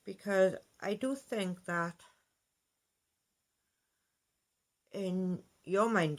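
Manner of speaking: shaken, slowed words; talking while being jostled; on a bumpy road. Real, not acted. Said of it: "sorry about the shaking there".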